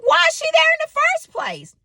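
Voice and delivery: high, indignant justification; mother defending herself to her son. Retold or imitated. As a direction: justify yourself, high and pushy. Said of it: "And I'm like".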